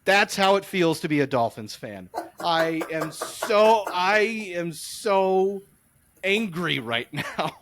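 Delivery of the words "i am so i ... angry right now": drawn-out frustration. Real, not acted. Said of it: "I had to just let it out".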